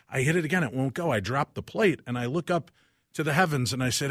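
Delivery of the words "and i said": ordinary talk, nothing marked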